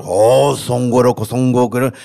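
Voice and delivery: mock gibberish in a trance voice; hint of an entranced man babbling; alien tongue. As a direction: imitate strange alien speech. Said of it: "empezó a hablar".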